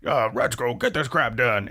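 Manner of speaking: boss impression. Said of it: "Haida does his Tone impression".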